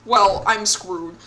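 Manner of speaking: loud, slow resigned line; drawn-out defeated sigh of words; loud slow I'm screwed; resigned groan. Real, not acted. Losing the fight.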